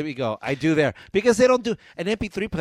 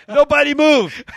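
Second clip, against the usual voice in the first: high, loud mock order; frantic shouting hostage taker; 11 semitones higher, 12 dB louder, slower than usual. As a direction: yell it at the whole cabin. Acting out air marshal.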